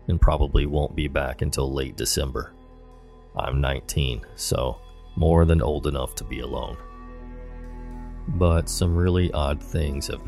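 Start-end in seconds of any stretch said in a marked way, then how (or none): none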